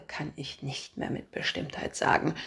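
usual speaking voice

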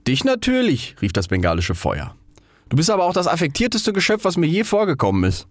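high, quick jibe; mocking Bengal fire. Bengal fire mocks the rocket, quick and high. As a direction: tease it sharply.

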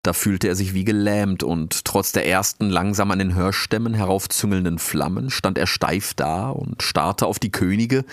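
usual speaking voice

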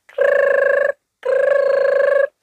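high buzzing ring sound; phone ring imitation. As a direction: imitate an old telephone ringing. Phone ringing again.

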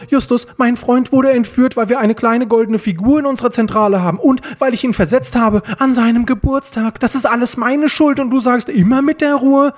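high, loud agitated rant; distraught outburst. A narrator's reading. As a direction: rail at a friend in guilty panic. Peter panics and rails at Justus.

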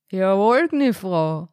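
lightly voiced mumbling innkeeper; a hint of indistinct landlord's murmur; murmured, slurred landlord. A narrator's reading. Landlord taking an order, lightly mumbled. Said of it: "murmelte der korpulente Wirt beinahe unverständlich".

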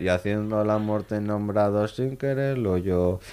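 stiff, mechanical lyric reading; robotic ATM voice. Retold or imitated. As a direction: imitate a cash machine singing. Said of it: "parecía un cajero automático de un banco".

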